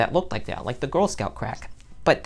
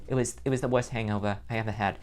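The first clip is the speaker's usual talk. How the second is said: normal talking voice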